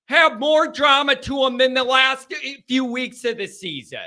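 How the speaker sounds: high-pitched, worked-up complaint; exasperated protest. Real, not acted. Exasperated rant about post-season drama.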